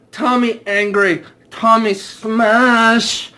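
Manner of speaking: mock caveman Tommy smashing things; caveman rage. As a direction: grunt it like a dumb brute smashing. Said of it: "caveman Tommy voice".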